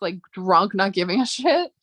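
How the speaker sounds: ordinary delivery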